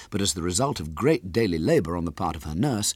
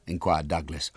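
ordinary delivery

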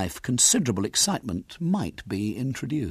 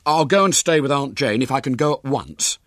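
eager schoolboy, louder; urgent boy voice; 8 semitones higher, 6 dB louder than usual. Acted. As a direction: press to leave at once. William urges departure.

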